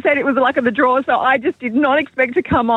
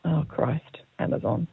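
low, near-whispered answer; hushed dismay; 8 semitones lower, 10 dB quieter than usual. Real, not acted. Contestant realising she knew the answer, hushed dismay. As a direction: breathe it out under your breath. Unsure caller guesses the bonus answer.

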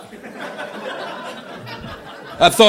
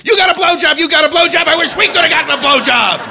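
hammed-up crowd yell; mock screaming crowd; 9 semitones higher than usual. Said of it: "They were screaming".

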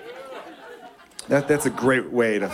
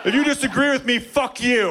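hammed-up drunk provocation at three A M; drunk party debater; 9 semitones higher than usual. Drunk guy starting a debate at a party, mock shouting. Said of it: "I'll be all like".

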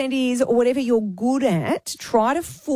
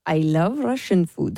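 slow fake Russian accent; 6 semitones lower, slower than usual. Shown as a demonstration. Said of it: "that was a bit more Russian".

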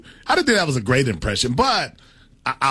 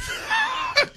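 high burst of laughter; 5 dB quieter than usual. Real, not acted. Laughs at prank.

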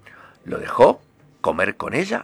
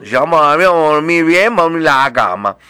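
insistent frog; 4 semitones higher, 6 dB louder, faster than usual. Acted.

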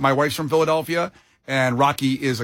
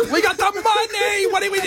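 much higher, whiny mock line; mock whiny Rocky. Host parodying the movie line, high and whiny. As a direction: whine it like a parody.

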